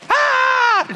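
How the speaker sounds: sudden high, loud yelp of alarm; a hammed-up shriek of fright; mock panic yelp. Driver spinning out on a rainy motorway, hammed-up yelp.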